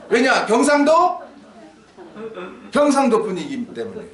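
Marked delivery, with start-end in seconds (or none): none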